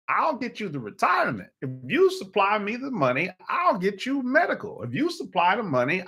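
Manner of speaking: high, insistent acted sales pitch; government making its pitch. Government bargaining with citizens like the pimp, insistent.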